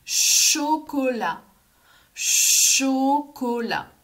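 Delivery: put-on slow, drawn-out syllables for young learners; stretched-out word. Shown as a demonstration. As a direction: stretch each sound, leaning into the first syllable. Teacher models word for children.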